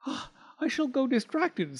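high, fretful mouse voice; high fretful scold. Acted. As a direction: despair at the mess. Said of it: "scolded Missus Tittlemouse".

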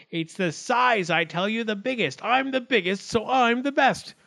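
high little voice, climbing as it insists; boastful acorn. A narrator's reading. Biggest acorn boasts in court, high and insistent. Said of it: "the acorns set up a commotion again".

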